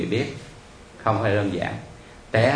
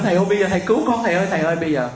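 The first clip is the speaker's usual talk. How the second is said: high, pleading cries, imitating the caller; wailing plea; 8 semitones higher, much faster than usual. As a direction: mimic someone begging frantically for help. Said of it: "thì nghe gào lên". (giả giọng gào lên cầu cứu)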